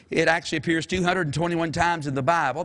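ordinary talk

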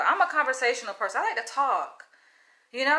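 ordinary delivery

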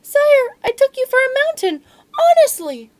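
very high, loud little mouse squeak; frightened mouse pleading with the lion, squeaky. Acted. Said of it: "found barely enough courage to squeak".

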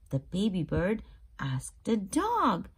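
plain reading voice

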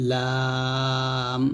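লাম held very long and thin; thin lam. Shown as a demonstration. Teacher modelling the letter, long and thin. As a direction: stretch লাম slowly and lightly. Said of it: "লামটা উচ্চারণ করবেন পাতলা করে".

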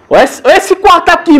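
real excitement at a creepy room; excited alarm. Real, not acted. Discovers eerie room.

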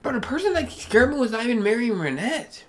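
very high, soft squeaky voice; high mock voice. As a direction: squeak it out in a silly voice.

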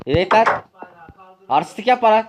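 high, loud rough shout; öfkeli bağırış. Acted. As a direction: shove him off verbally. Kavgacıyı kovma. (sert ve yüksek sesle kovma)

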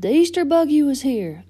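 high, loud announcement; excited shout. A narrator's reading. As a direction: holler the big news. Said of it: "Mater shouted".

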